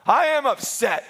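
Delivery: high-pitched mock-indignant retort; retold indignation.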